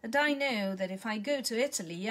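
ordinary delivery, nothing marked

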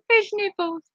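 high, giggly, breaking into laughter; cracking up at the silly phrase; laughing. Real, not acted. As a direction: laugh the words out.

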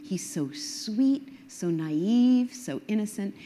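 high, slow, lightly swooning gush; mock swooning woman. [name] gushing over the con man, a mock swoon, high and slow. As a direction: play the smitten woman charmed by his accent, drawn out and high. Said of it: "and she says".

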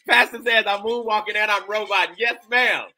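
high, loud, exuberant call; excited hollering. Real, not acted. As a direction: cheer along with the music. Joking excitedly over music.

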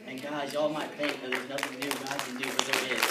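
loud, fervent testimony close; rousing exhortation. Real, not acted. Testimony builds to closing exhortation.

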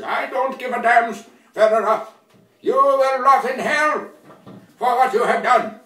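angry threat. Acted. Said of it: "He says".

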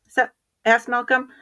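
plain reading voice

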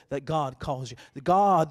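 normal talking voice

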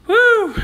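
sudden high, loud woo; woo cheer. Real, not acted. Streamer cheering mid-chat, loud whoop. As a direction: whoop with delight.

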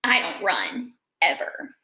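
lively picture-book read-aloud; reading to children. A narrator's reading. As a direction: read it to a class of kids.

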